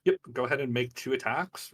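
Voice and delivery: usual speaking voice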